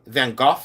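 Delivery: Gogh as "goff", questioning lift; silent-g pronunciation. Retold or imitated. Seller reading viewer's correction, Gogh as "goff". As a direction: try Gogh as "goff", rising. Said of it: "the G is silent".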